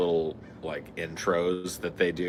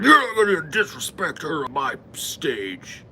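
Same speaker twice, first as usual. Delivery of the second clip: angry host; 8 semitones higher, 4 dB louder than usual. Retold or imitated. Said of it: "He's like".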